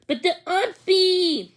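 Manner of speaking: put-on high, drawn-out little-kid plea; a small boy begging for the puppy; toddler whine. Little brother pleading for the puppy, high toddler whine.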